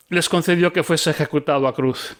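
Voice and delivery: plain reading voice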